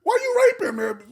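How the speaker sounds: very high, loud squawk; high-pitched mock outrage; accusing passerby. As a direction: imitate a passerby hollering an accusation. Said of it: "everybody was going by. It's like".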